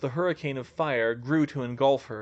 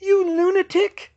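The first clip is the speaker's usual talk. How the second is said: very high, loud panicked scream; shrieked accusation; 9 dB louder than usual. A narrator's reading. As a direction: scream it at a madman. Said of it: "she screamed".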